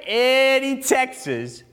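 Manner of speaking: slow, exaggerated country accent. Shown as a demonstration. Pastor shows how to say the town name, country accent. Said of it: "you gotta say it with that country accent".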